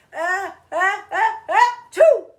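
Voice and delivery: building high, loud sneeze; theatrical ah-choo for kids; big fake sneeze. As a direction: ham up a sneeze that builds and bursts. Acting out a sneeze.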